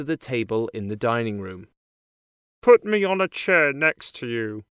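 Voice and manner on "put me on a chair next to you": lightly voiced frog character; a hint of a croaky frog. A narrator's reading. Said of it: "he tells the princess".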